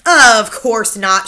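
put-on loud, slow and firm old grandmother; slow, loud grandmotherly scoff; grandmother's firm reply. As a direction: dismiss it flatly as the grandmother. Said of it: "she said".

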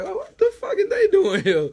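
high, startled mock voice; mock surprised self. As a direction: squeak it out in disbelief. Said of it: "I always came to school like".

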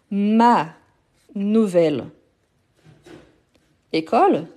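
"ma... nouvelle... école", a long pause between words. Shown as a demonstration. Teacher giving an example before a consonant, word by word. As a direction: set "ma nouvelle école" out one word at a time.

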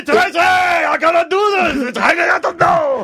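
high, shouted hammed-up wail; mock crying. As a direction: mimic his sobbing to Tracy at full pitch. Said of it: "But I wasn't crying".

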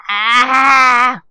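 drawn-out exasperated "ah"; exasperated open-mouthed cry; frustrated yell. Real, not acted. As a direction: groan out loud at a lost word. Stuck on a word, frustrated.

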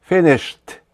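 "Finished" as "finisht", E silent; two syllables in "Finished", closing with a crisp T; voiceless ending on FINISHED. Shown as a demonstration. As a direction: end "Finished" on a T sound. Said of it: "Так само не дзвінка D в кінці".

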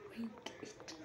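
breathy hushed plea; will the block to break under your breath; whispered urging. Real, not acted. Player begging the block to break, hushed whisper.

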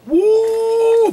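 high, loud falsetto whoop; excited whoop. Real, not acted. Showing limited edition cookies, a loud high whoop. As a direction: shriek a joyful woo.

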